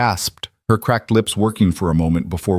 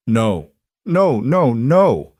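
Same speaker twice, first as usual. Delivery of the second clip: rising panicked no's, louder; growing panic, higher each time; 3 semitones higher, 3 dB louder, much slower than usual. Acted. Engine approaching, panic.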